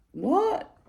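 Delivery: high, incredulous what; shocked what. Retold or imitated. Wife hearing the neighbours are joining the sale, shocked. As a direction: squeak it out in disbelief.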